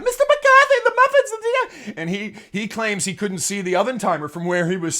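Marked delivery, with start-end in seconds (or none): 0.0-1.9 high, loud, frantic maid's shriek; screaming maid. Retold or imitated. Maid screaming at the coach, very high and loud. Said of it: "The maid is screaming at him".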